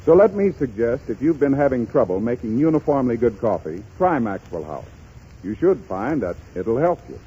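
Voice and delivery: ordinary talk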